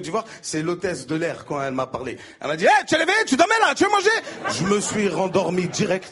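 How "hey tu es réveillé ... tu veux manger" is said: high, loud, brash call; loud Ivorian hostess. Acted. Flight attendant waking the passenger, high and loud. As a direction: rouse him loudly.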